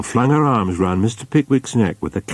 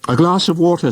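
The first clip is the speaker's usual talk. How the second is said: much higher, louder, kindly urgent call; humane Mister Pickwick; 11 semitones higher, 4 dB louder than usual. A narrator's reading. Pickwick calling the landlady for water, high and urgent. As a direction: call for water for the fainted lady. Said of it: "said the humane Mister Pickwick".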